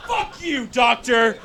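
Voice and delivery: high, hushed strained outburst; mock angry. Patient cursing his doctor at the TV, strangled mock-angry cry. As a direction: squeeze the insult out high with little volume. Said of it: "I got so ad-, angry".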